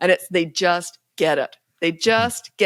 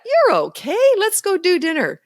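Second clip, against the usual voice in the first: much higher, upbeat brush-off; bright and matter-of-fact; cheerful parent; 11 semitones higher, 3 dB louder than usual. Retold or imitated. Parent voiced after tantrum.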